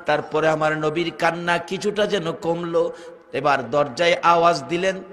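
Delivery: usual speaking voice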